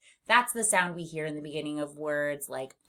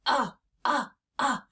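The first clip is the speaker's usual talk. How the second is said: belly-push grunt; 6 semitones higher than usual. Shown as a demonstration. Teacher shows pushing the belly for "uh". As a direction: grunt "uh" as the tummy goes in. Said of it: "So you can see".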